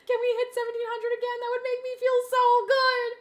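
loud, high-pitched plea to chat; high pleading. Pretend. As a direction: beg excitedly in a high, loud voice. Begs chat for subs.

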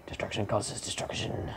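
soft, unvoiced menacing whisper; mock sinister whisper. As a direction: breathe it like a villain. Ominous whispered musing.

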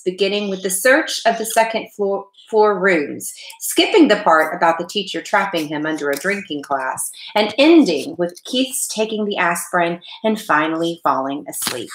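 ordinary delivery

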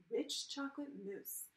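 usual speaking voice

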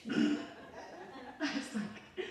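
normal talking voice

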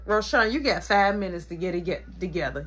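low, restrained ultimatum; stern warning. Acted. Mama giving five minutes, low stern warning. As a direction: warn sternly, keeping the pitch down.

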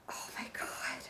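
barely audible, stunned whisper; real hushed awe; awed whisper. Real, not acted. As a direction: whisper it in sheer disbelief. Stunned by a dream brand.

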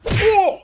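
high loud yelp of a punch; pained yelp. A narrator's reading. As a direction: cry out as if hit. Chin punch sound.

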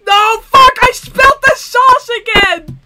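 loud high frustrated shout; frustrated yell. Real, not acted. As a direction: yell at the spilled sauce. Spilled the sauce.

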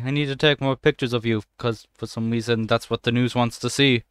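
usual speaking voice

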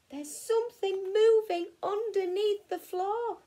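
ordinary delivery, nothing marked

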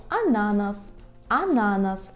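ананас stressed on the middle syllable, a-NA-nas; penultimate stress. Shown as a demonstration. Teacher models Polish pineapple, ананас stressed a-NA-nas. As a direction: lean on the second syllable of ананас. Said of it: "наголос повинен бути саме такий".

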